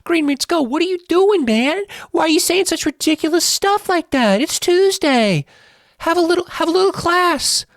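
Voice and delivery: high, loud hammed-up squeal of indignation; mocking outraged fan. Imagined viewers scolding host over Bears prediction, high mocking outraged squeal. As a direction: play the scandalised viewer scolding him, shrill and over the top. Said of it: "And you're gonna say".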